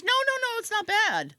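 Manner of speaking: high-pitched imitation of a reassuring nurse; hasty reassurance. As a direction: play the nurse rushing to say it's fine, pitched high.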